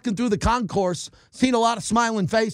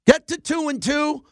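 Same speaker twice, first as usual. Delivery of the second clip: rallying cry; 8 semitones higher than usual. Real, not acted.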